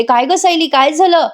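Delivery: imitated anxious concern; worried. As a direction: ask in acted alarm what happened. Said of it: "अस्मिता पण काळजीने म्हणते".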